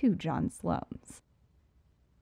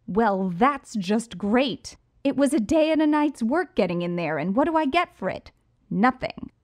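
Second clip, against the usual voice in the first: furious loud rant; angry; 4 semitones higher, 6 dB louder than usual. Acted. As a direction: vent the rage loudly. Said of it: "I was livid".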